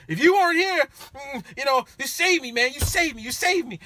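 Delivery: high, loud slurry gratitude; drunk gushing thanks. Retold or imitated. Drunk rider thanking driver over and over, high gushing voice. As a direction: gush it like a sloppy drunk.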